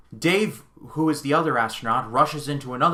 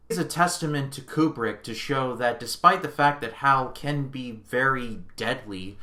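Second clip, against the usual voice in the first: ordinary talk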